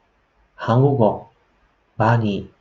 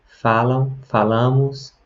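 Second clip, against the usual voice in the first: usual speaking voice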